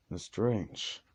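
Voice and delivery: usual speaking voice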